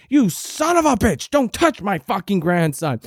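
high mock yell at the stranger; grandma yelling. Grandmother telling off the woman who grabbed her grandson, high mock yell. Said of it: "She kept saying".

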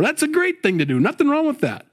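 high-voiced, emphatic reassurance; emphatic approval. Real, not acted. Approves giving, emphatic aside.

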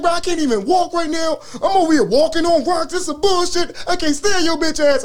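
high-pitched retold tirade; laying into him. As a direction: act out a worked-up complaint, voice way up. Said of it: "I'm just laying him".

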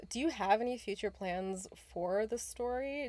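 ordinary talk

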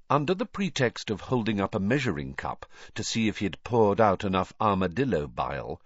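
usual speaking voice

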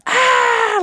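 loud high sister's yell; a mock scream from the bathroom; retold yell from shower. Sister screaming in the shower to scare her, loud yell. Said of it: "like, yell loud".

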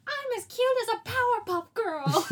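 high, loud cartoon squeal; Scrappy. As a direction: imitate a tiny cartoon character yelling. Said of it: "one of the redeemable parts about Scrappy".

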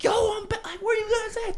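hammed-up relieved yell; mock eager call. Calling his homies after getting out, high and excited.

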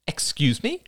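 sharp, high-pitched challenge. A narrator's reading. Rachel bristles at Oliver's remark on female response, sharply. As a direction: take offence, pitch jumping up. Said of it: "said Rachel sharply".

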